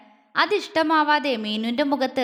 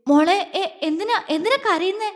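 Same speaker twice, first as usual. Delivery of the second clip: higher-pitched anxious questioning; tense worry; 6 semitones higher than usual. A narrator's reading. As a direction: stumble into the question, alarmed. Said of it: "Vyas ടെൻഷനോടെ ചോദിച്ചു".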